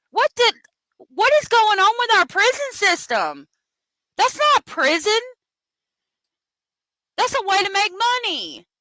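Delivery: real anger boiling over, pitched high; high, furious disbelief; pissed-off outburst. Real, not acted. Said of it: "Sorry, I'm pissed off".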